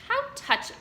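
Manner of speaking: high mock-sweet sarcasm; sarcastic. A narrator's reading. Templeton sneering at Wilbur's plea, high and sarcastic. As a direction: jeer at his pain.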